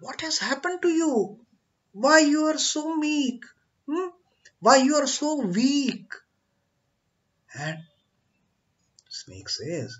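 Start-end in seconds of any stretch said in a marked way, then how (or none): 0.0-6.1 much higher and fast, in alarmed questions; surprised guru. Acted. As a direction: rattle off the questions in shock. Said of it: "is surprised to see his condition".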